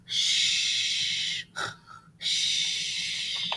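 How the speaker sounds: long unvoiced hush; soft drawn-out shh; shushing. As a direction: hush them so the bear sleeps on. Hushing children near sleeping bear.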